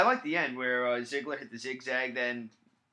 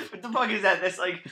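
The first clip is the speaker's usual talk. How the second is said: laughing; 6 semitones higher, 3 dB louder than usual. Real, not acted. Reacting to a joke.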